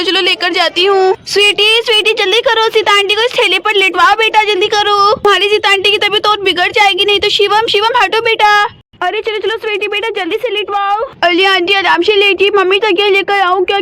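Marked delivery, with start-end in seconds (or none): none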